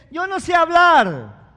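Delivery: hammed-up pleading; mock whining excuse. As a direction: stretch it out like a lame excuse. Preacher mocks prophet's excuse.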